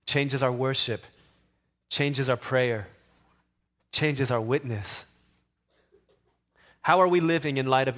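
earnest pastor leading a prayer. Acted. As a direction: invite the church into prayer, steady and sincere. Leads congregation in prayer.

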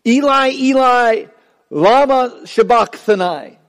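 slow, high, drawn-out cry of despair; anguished cry. Retold or imitated. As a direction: cry it out slowly, forsaken and pleading. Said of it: "And he yells".

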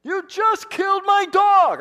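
high, loud, stretched-out scream at the driver; furious yell. Retold or imitated. Boy yells at driver who killed his dog, furious scream. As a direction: yell it out the window at him, heartbroken. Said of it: "I yelled at this guy".

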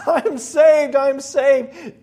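loud, high cries of relief; overjoyed shouting; joyful screaming. Acted. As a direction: shout it out in joy. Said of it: "Rahab is screaming".

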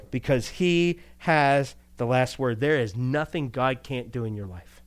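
ordinary talk

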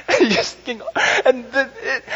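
words pushed out high through laughter; laughing while talking. Real, not acted. Preacher to congregation, laughing at bad contestants. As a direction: talk through the giggles.

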